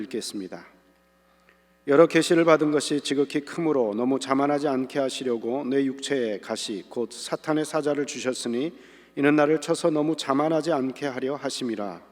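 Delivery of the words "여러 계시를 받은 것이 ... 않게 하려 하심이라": measured, solemn Bible reading; scripture reading. Acted. Reads scripture aloud.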